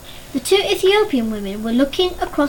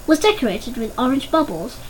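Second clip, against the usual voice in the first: plain reading voice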